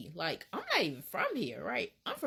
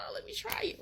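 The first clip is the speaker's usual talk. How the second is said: whispered excitement; 12 semitones higher, 3 dB quieter, much faster than usual. Retold or imitated. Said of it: "I'm like".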